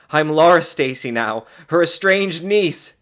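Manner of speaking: high, loud, gleeful announcement; exclaiming. A narrator's reading. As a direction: proclaim the new identity triumphantly. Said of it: "she exclaimed".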